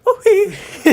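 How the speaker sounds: a sudden high, loud yelp; loud quote. Retold or imitated. Friend Jordan reacting to the message, loud high outburst. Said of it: "and he's like".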